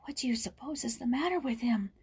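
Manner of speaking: scared, unvoiced whisper; frightened whisper. Jules whispering to Joyce after the outburst, frightened whisper. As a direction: whisper it fearfully behind the door. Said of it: "in a frightened whisper".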